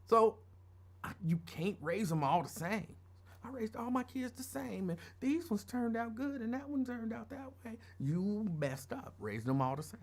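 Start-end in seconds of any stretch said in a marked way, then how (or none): 3.4-7.8 high, soft mocking imitation of parents; mock complaining-parent voice; mimicked parent. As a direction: mimic them in a higher, lighter voice. Mimicking parents who complain about kids.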